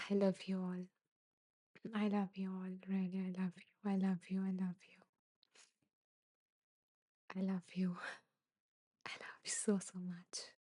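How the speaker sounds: choked up, barely able to get the words out; tearful whisper. Real, not acted. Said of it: "that is the best kind of crying".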